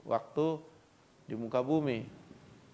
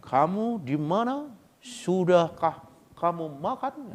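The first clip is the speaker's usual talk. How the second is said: mock Batak accent, higher and slow; 5 semitones higher, 3 dB louder, slower than usual. Preacher imitating a Batak man asking the foreigner, mock Batak accent. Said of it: "apalagi orang Batak".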